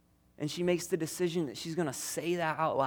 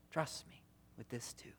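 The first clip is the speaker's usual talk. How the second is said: barely voiced, hushed plea; whispered trust; 2 semitones lower, 15 dB quieter than usual. Preacher whispers Jesus's gentle promise to the grieving. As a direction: whisper the promise, let it fade.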